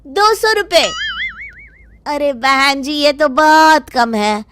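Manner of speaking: shopkeeper luring a customer; pushy shopkeeper. Acted. Shopkeeper bargains. (दुकानदार का लुभाता अंदाज़)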